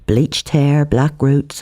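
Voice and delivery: plain reading voice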